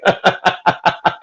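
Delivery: helpless burst of staccato laughter; rapid cackling giggle fit. Real, not acted. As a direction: crack up mid-sentence. Bursts out laughing mid-quote.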